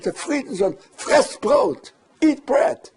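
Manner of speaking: high, loud imitated yell; retold shout. German boss shouting at Friedensohn to eat bread, high loud yell. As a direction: bark the order like the boss did. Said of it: "he even shouted".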